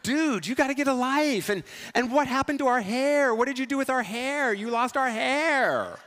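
a teenager mocking his older self; teen slang younger self. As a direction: scold him like your teenage self. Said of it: "younger me would totally say dude".

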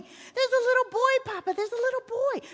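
put-on high, urgent child's voice; a distraught boy desperate to be believed; insistent boy. A narrator's reading. Excited child pleads with father.

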